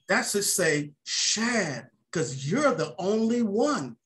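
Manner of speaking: slow, lightly voiced old man. Old Mister Tucker to the young tuner, slow and tender. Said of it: "He says".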